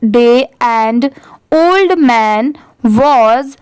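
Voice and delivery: very slow word by word reading; teacher reading the line very slowly. Shown as a demonstration. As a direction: read each word slowly so a learner can follow. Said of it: "तो पूरा क्या है".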